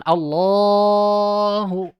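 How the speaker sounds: Allahu stretched far too long; overlong Allahu. Shown as a demonstration. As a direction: overdo the length of Allahu. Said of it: "jangan terlalu panjang". (Allahu terlalu panjang salah)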